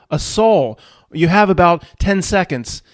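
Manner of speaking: normal talking voice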